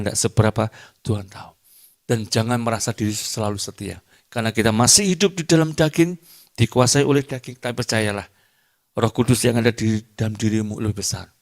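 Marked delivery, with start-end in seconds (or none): 4.3-7.6 higher and louder, driving the point home; preaching at full force. Acted. Pastor thunders to his congregation about the flesh, louder. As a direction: preach it hard, voice lifted.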